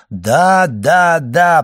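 loud, high, emphatic delight. A narrator's reading. Old man overjoyed at being understood, delighted and loud. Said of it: "в восторге от того, что его поняли".

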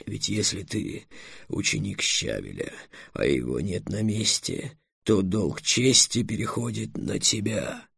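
slow, soft, weighty dragon; dragon Эллен, earnest. Acted. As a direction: explain the debt slowly and gravely. (медленный, негромкий, веский голос дракона)

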